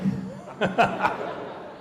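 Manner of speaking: a short, breathy burst of real laughter; high, airy chuckle; laughing. Real, not acted. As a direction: laugh at your own story. Speaker laughs at the story.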